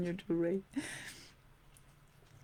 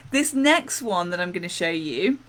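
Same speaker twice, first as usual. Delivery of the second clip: usual speaking voice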